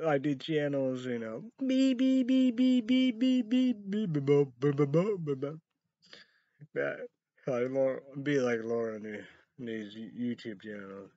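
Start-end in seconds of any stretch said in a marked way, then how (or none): 1.7-5.6 beep, beep high and loud like a censor tone; mock censor bleep. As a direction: imitate a TV bleep with your voice. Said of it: "That's a great beep".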